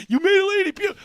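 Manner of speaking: high, loud imitated exclamation; amazed mock shout. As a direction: holler it like an astonished fan. Crowd's amazed reaction, acted out.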